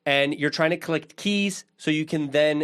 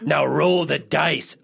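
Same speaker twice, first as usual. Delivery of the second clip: gatekeeper giving orders; 2 semitones lower, slower than usual. Retold or imitated. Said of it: "he was like".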